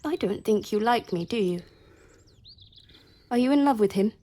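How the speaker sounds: jealous outburst at the rival; sharp jealous demand. Acted. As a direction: throw it at her like an accusation. Jealous challenge to the servant.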